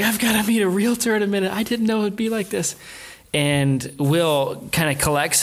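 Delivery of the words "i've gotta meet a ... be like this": mock teary babble, much higher and fast; hammed-up weepy panic; imitating tearful wife. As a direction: play her as a wife sobbing on the way to the realtor. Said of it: "She starts crying so hard".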